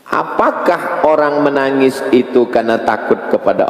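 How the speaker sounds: high, ringing sermon cadence; preaching at full force. Acted. Preacher asking the congregation why people weep, fervent preaching. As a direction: lift the crowd with each question. Preacher rising on weeping topic.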